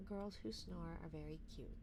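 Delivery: usual speaking voice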